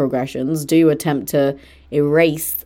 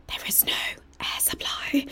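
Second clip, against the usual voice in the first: whisper; 12 dB quieter than usual. Pretend. Joking about stuffy studio.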